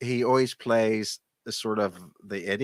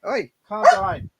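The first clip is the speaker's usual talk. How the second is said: raised voice, calling off a barking dog; sharp, louder call to a pet; 6 dB louder than usual. Real, not acted. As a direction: break off to hush the dog, louder.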